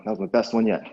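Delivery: ordinary delivery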